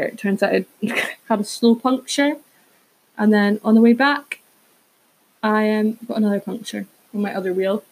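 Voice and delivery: ordinary delivery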